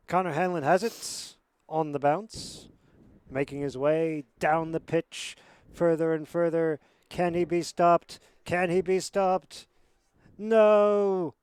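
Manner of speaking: loud commentary building to a high, long shout; excited play-by-play. Real, not acted. Commentator calling a breakaway run down the pitch, building excited play-by-play. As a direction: build with the runner to a triumphant cry.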